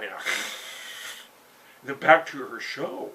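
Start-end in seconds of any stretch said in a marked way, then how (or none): 0.2-1.8 long cat-like hiss; angry hiss. Acted. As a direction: hiss at him, long and unvoiced. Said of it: "and hisses at him".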